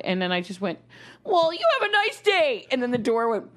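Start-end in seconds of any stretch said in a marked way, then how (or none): 1.2-2.7 high mock-polite farewell. After being punched on subway.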